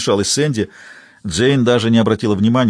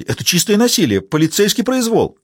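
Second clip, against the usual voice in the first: flustered alarm; 10 semitones higher than usual. A narrator's reading. Blonde squealing in alarm at police brutality. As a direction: panicky little outcry. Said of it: "всполошилась Сэнди".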